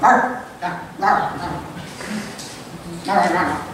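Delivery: low, rough imitated barks like a dog; mock barking. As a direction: bark it out in mock frustration. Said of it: "I kind of barked it out".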